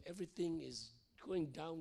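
normal talking voice

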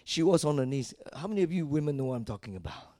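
ordinary talk, nothing marked

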